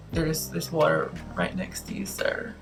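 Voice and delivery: quiet. Retold or imitated. Server answering flatly.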